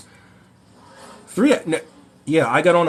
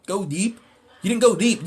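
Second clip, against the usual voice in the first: normal talking voice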